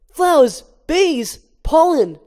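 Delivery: high, loud eager list; excited listing. Acted. Barry eagerly explains his plan to Vanessa, high and loud. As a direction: pitch the idea breathlessly.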